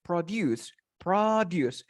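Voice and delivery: "Produce" stressed on the last syllable, pro-DUCE; stress at the end. Shown as a demonstration. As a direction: lean on the second half of "Produce". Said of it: "The stress goes at the end of the word".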